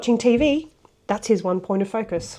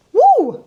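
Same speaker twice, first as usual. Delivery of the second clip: a high, loud whoop to shake it off; a sudden high woo before calming the viewer; 14 semitones higher, 6 dB louder than usual. Acted. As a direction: let out a big excited woo. Shaking off angry energy.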